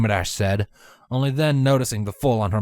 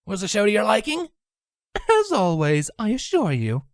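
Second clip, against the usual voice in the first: put-on old mare voice; 9 semitones higher than usual. Mother voiced.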